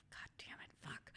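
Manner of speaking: a frustrated curse in a whisper. Retold or imitated. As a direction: hiss the curse under your breath. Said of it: "I'm like".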